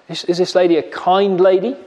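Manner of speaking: ordinary delivery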